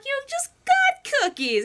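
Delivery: very high, drawn-out protest; Misty. Acted. Girl protesting the boy's cookie excuse, high and insistent.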